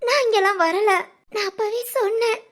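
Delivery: anxious, hurried denial at first, then subdued; tearful pleading. Acted. Wife assuring husband she won't intrude, tearful plea. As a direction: protest humbly, close to tears.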